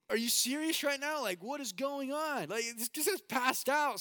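high, loud acted panic; storyteller's full-voiced panicked outburst; freaking out. Passenger alone with a passed-out pilot, loud high freak-out. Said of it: "freaking out".

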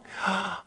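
high, soft gasp of surprise; delighted gasp. Real, not acted. Spotting a returning viewer, delighted gasp.

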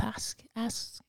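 breath-only inner nudge; whispered prompting. Retold or imitated. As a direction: whisper it, almost without voice. Inner prompting to ask husband.

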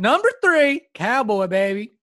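hammed-up cowboy hype call; goofy cowboy showman; funny cowboy voice. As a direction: announce it like a silly cowboy. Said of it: "if you're gonna do that voice".

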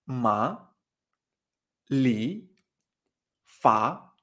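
"Ma", "li", "fa" each gliding upward; rising second tone. Shown as a demonstration. Teacher asking learner to repeat, each syllable rising. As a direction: model "Ma", "li", "fa" as separate questions. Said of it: "este es el segundo tono".

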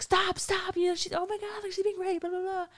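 high panicky mock cry; frightened girl. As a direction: imitate the scared inmate pleading. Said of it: "this girl, she's like".

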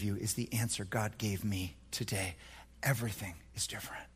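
fast whispered awe. Retold or imitated. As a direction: breathe it out as a secret. Man's testimony, whispered.

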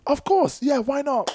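high, bright mock-eager agreement; eager yes. Dancer telling the woman he'll happily teach, in eager high voice. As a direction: jump at the offer, voice up high. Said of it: "And I was like".

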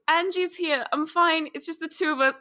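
lightly acted phone voice; on-the-phone reassurance. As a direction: reassure the caller a bit too brightly. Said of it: "She uncovers the mouthpiece and goes".

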